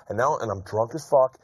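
ordinary talk, nothing marked

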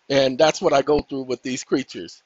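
normal talking voice

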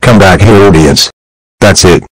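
furious shout. Acted. Angry parent grounding the kid, furious loud yell. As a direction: scream the grounding at him.

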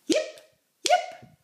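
very high, short yips; squeaky little yips; fox yips. A narrator's reading. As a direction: yap like a fox. Imitates the fox.

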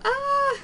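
high-pitched excited squeal; freak-out squeal. Real, not acted. Gamer overwhelmed by the game, squealing in a freak-out. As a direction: let out a high, drawn freak-out "ah". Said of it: "I'm freaking out".

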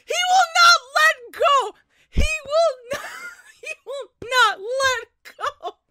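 high, loud giddy insistence; giddy shout. Real, not acted. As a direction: cry it out laughing with joy. Fan squealing at anime scene.

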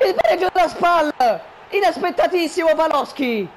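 shouted, high goal call; goal commentary shout. Acted. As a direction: yell the equaliser like a commentator.

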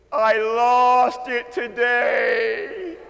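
drawn-out, loud wail of "I lost it today"; a mother wailing that she lost it; distressed mother's cry. Retold or imitated. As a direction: let the mother's panic burst out slow and loud.

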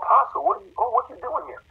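high, startled voice of a woman opening the door; surprised woman at the door. Retold or imitated. Woman opens the door to her pastor, startled and high. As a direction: sound caught off guard, high.

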